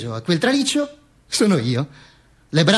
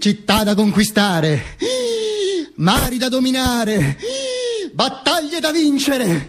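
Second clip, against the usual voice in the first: higher, slow, soaring proclamation; grandiose dreaming; 7 semitones higher, slower than usual. Acted. Dreaming Duce lists his glories, slow and soaring. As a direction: savour each conquest slowly.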